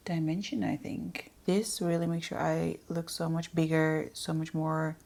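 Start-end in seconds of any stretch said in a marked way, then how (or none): 0.1-1.3 soft unvoiced aside; a hushed little afterthought; whisper. Real, not acted. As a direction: whisper it to yourself. Aside while doing eye makeup.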